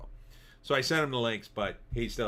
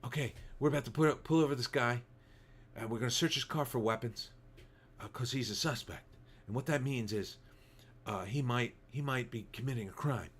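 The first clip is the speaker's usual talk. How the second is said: lightly voiced Seagal, soft and murmured; a hint of Seagal, mumbling mock cop lines; Steven Seagal impression; 4 dB quieter than usual. Streamer mocking Seagal's reality show lines, soft mock murmur. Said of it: "I can't do Steven Seagal".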